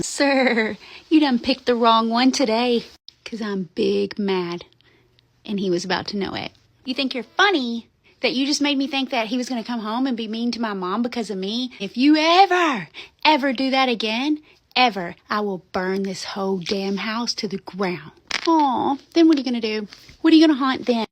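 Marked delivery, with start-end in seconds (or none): 0.0-2.8 loud warning, big mad; teen warns the ghost who pranked her, angry. Retold or imitated. 6.8-21.1 loud furious rant, peaking in shouts; teen threatens to burn the house down, furious; angry. Retold or imitated.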